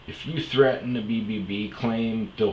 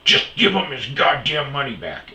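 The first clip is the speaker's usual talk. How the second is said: angry manager snap; 7 semitones higher, 8 dB louder than usual. Retold or imitated. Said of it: "And he storms off".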